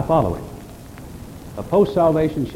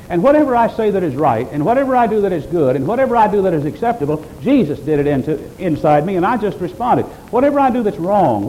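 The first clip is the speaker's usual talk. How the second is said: higher and louder, driving each "whatever" home; full-force preaching; 5 semitones higher, 4 dB louder than usual. Acted. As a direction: preach it hard to the congregation.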